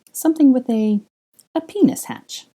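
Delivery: an excited high pitched proposal; giddy. A narrator's reading. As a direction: blurt it out, climbing in pitch.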